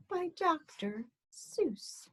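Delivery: soft whispered title; hushed reveal of the author. A narrator's reading. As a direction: whisper it like a secret.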